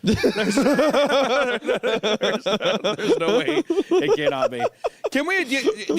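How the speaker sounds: high giggling laugh through words; laughing so hard it pitches up. Real, not acted. Laughing fit at a joke.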